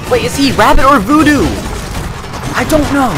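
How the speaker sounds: high, loud shout over battle din; shouting over noise. Real, not acted. Gamer to viewers as the cutscene's loud noises hit, shouting. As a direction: yell to be heard above the racket.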